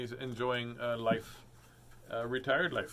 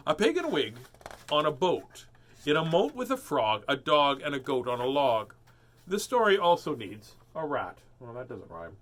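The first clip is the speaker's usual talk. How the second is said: lively, sing-song storybook reading; animated picture-book read-aloud; reading to children; 3 semitones higher, 4 dB louder than usual. A narrator's reading.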